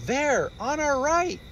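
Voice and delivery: high squeal pointing out a splash; high excited cub. Acted.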